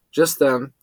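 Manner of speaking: ordinary delivery, nothing marked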